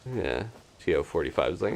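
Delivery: ordinary talk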